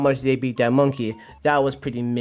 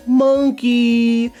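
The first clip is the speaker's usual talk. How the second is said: MONKEY belted high, loud and long; drawn-out punchline; 9 semitones higher, 7 dB louder than usual. Real, not acted. Streamer landing his joke for chat, belting MONKEY. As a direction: sustain "monkey" like a hype announcer.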